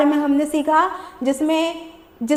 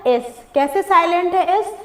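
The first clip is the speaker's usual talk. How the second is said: normal talking voice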